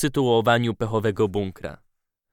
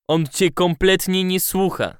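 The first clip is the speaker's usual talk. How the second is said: reproachful; 6 semitones higher than usual. A narrator's reading. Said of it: "z wyrzutem w głosie".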